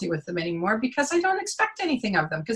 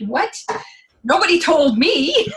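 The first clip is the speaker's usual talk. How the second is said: higher, louder, laughing disbelief; laughing surprise; 8 semitones higher, 4 dB louder, slower than usual. Real, not acted. Coach finding her new job title in the staff memo, laughing shock. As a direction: gasp it out through a laugh. Discovers she was hired.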